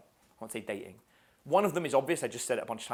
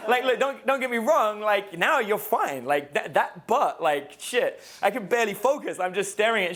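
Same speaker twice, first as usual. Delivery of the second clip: brash flirt; 6 semitones higher, 5 dB louder than usual.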